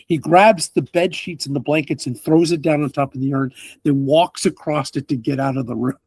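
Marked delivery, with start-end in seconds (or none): none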